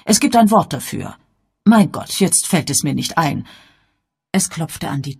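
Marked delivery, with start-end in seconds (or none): 0.0-3.5 höher und lauter, nach dem Wort suchend; alte Dame, grübelnd. Acted. Miss Marple searching for word. (Miss Marple sucht ein Wort, grübelnd)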